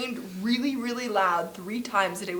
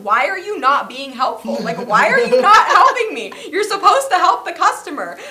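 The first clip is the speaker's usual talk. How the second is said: high, loud, whiny outrage; exasperated rant; 8 semitones higher, 9 dB louder than usual. Retold or imitated. To the Walmart manager, high loud exasperated complaint. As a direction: replay the fury at the manager, voice climbing.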